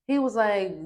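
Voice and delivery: usual speaking voice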